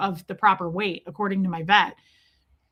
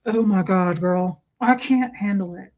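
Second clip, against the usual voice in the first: lightly put-on smitten voice; a hint of cartoon lovesickness; Pepe Le Pew. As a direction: swoon over an adored dog. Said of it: "I get weird like Pepe Le Pew".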